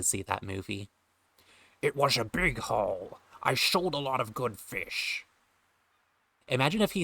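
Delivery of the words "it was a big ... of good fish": slow Scottish sailor, sh for s; slow, lilting Connery old fisherman; Sean Connery voice. Acted. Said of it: "Sean Connery aspect of me doing this voice".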